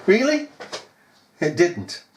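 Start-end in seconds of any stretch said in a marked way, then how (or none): 0.1-0.5 mock disbelief. Mock incredulous question again.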